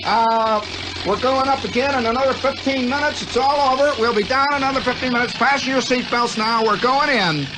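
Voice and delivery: high brisk announcer voice; pilot announcing. Retold or imitated. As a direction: call out to the passengers, hurried. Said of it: "He turns around, he says".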